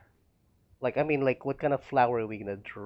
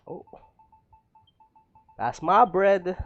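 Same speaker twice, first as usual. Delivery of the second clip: surprised; 8 semitones higher, 11 dB louder, slower than usual. Real, not acted. Notices item in game.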